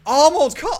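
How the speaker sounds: gasp, then a high, loud cry; excited exclamation. Real, not acted. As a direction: burst out at a sudden discovery. Sudden gasped exclamation at the game.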